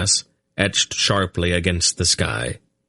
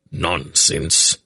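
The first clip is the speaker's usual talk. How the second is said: snorted; 3 semitones lower than usual. A narrator's reading. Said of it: "Belgarath snorted".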